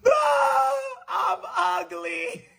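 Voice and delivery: high wailing sob, then stretched-out slow crying; hammed-up six-year-old bursting into tears; mock crying wail. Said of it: "I just bust out into cry".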